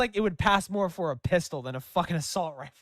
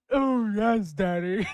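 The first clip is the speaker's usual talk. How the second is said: put-on character voice; 2 semitones higher, 4 dB louder, slower than usual. Streamer reading game dialogue in a voice, hammed-up role. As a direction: play the embarrassing line up for laughs. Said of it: "what kind of voice do I read it".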